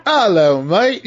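a cartoon Brit saying hello, overdone; mock British accent. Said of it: "What if you're like".

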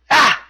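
short, high, loud yelp; one sudden punched "Ah!" in imitation; Tozawa's "Ah!" cry. Wrestler answers into the mic, short high imitated yelp. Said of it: "Tozawa simply says into the mic".

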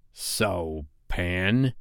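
low, quiet villain; brooding villain voice, held down; Hook. A narrator's reading. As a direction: confront your enemy softly and low. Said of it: "said Hook at last".

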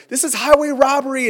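high, loud indignant protest; worked-up customer complaint; acted outrage. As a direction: protest hotly, pitch jumping up. Acted outrage at insurance company.

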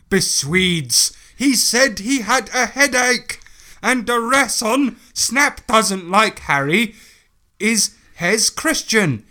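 mock a defensive amateur writer, word by word; exaggerated halting read of a ranty note; put-on author's-note rant.

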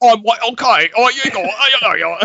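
mock Michael Caine doing himself badly; bad Michael Caine impression. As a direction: ham up a poor Caine impression. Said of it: "he's like".

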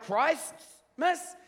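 high, baffled "Christmas?" as a question; puzzled alien. Retold or imitated. Alien repeats the word to Michael, baffled. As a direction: echo the word in disbelief.

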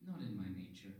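very soft otherworldly refusal; mysterious orb voice. Acted. Orb declining to help, hushed eerie voice. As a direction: refuse quietly as an uncanny presence.

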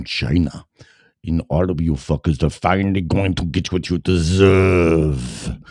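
mock Russian accent, menacing threat; Drago.

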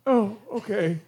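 a hint of the frog. Frog character asks questions.

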